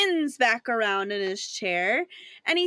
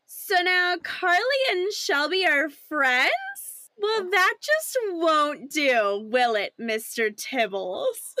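high, drawn-out camp scheming; theatrical camp purr to a pet; 6 semitones higher, slower than usual. Acted.

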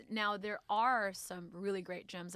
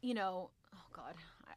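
whispering; 6 dB quieter than usual. Real, not acted. Catches herself mid-controversy.

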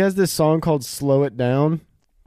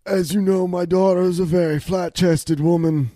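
raspy mob boss. Acted. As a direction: growl it like an aging don. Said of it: "not a mobster accent. It's just raspy".

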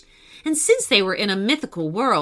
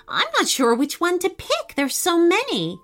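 put-on higher, bright girl's voice; young girl voice; 7 semitones higher than usual. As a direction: voice the little mermaid girl.

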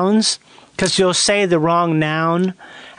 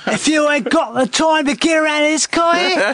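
put-on British pub-brawler accent, pitched up; hammed-up British thug accent; Guy Ritchie street tough; 6 semitones higher than usual. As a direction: threaten like a London film gangster. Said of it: "What about Guy Ritchie movie?".

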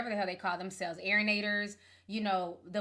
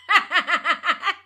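loud high laugh; 7 semitones higher, 9 dB louder than usual. Real, not acted. Laughing at a fan nickname.

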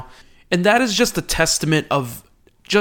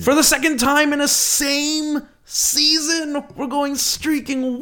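high, slow, drawn-out show announcer; hype announcer intro; 11 semitones higher, slower than usual. Acted. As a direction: open the show like a big arena announcer.

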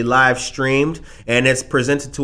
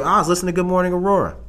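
fast, higher mock excuse; sheepish excuse; 6 semitones higher, much faster than usual. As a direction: blurt out the alibi. Worker's quick excuse.

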